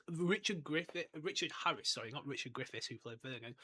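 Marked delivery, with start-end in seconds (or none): none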